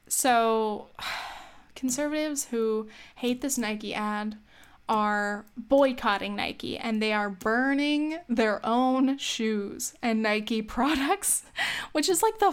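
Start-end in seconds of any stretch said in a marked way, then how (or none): none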